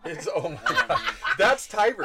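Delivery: high, loud, laughing through it. Real, not acted. Teasing her friend about the pens, laughing. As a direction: burst out laughing.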